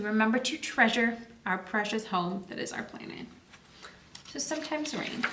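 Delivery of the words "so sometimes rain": ordinary delivery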